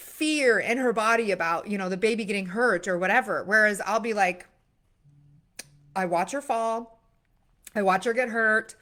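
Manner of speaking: ordinary talk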